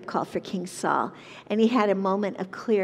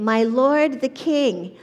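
slow, louder call to the king, pitched up; 4 semitones higher, 6 dB louder, slower than usual. A narrator's reading. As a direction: call after the king with reverence, drawn out. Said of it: "called after him".

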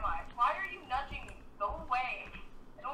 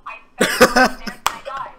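mock yell; 18 dB louder than usual. Yells catchphrase after dying in game.

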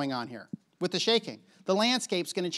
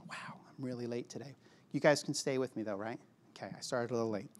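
quiet aside; 8 semitones lower, 10 dB quieter than usual. Real, not acted. Aside about running late.

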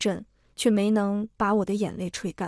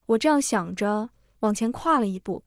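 ordinary delivery, nothing marked

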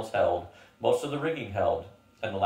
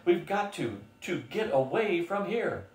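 frantic; 7 semitones higher than usual. Acted. Terrified schoolmaster pleading to flee.

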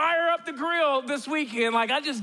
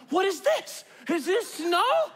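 mock shocked Minnesotan; 7 semitones higher than usual. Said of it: "The first".

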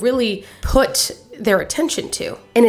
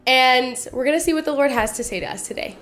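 ordinary talk

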